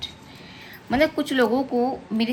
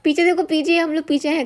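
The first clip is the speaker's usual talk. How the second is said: mother's call-out, retold with raised pitch; menirukan teriakan ibu; 8 semitones higher than usual. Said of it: "तो mummy चिल्लाई".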